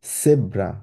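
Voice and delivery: জেব্রা pronounced "sebra", z as s; German pronunciation. Shown as a demonstration. Teacher repeating the example for learners, জেব্রা with an s onset. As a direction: start জেব্রা on an s sound. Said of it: "S এর মতো উচ্চারণ করি".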